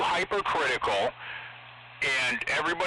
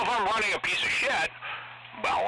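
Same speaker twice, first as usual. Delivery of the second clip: ordinary talk, nothing marked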